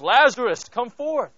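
loud, ringing call to raise the dead; commanding call. Retold or imitated. Jesus calls Lazarus out of the tomb, loud command. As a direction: call him out of the tomb, full voice.

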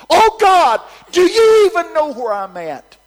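high, full-volume cry to God; retold scream. Tired young woman screaming to God on the porch, retold. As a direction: scream it out in exasperation, re-enacting. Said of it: "and I screamed".

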